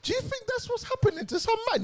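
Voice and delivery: squeaky high voice; high-pitched voice. Pretend. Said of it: "You have to be high pitched for me to say this".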